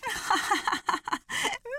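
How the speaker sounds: high mocking hare laugh; hare's laugh. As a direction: laugh down at him.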